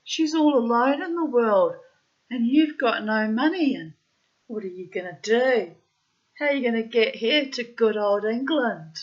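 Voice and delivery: high, loud, swooping disbelief; hammed-up dismay building through each question; acted agitated protest. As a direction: protest loudly, pitch leaping.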